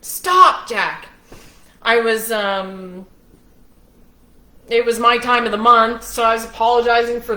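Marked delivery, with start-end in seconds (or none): 0.0-1.1 real raised-voice interruption; calling out. Real, not acted. Breaks off story to tell Jack off.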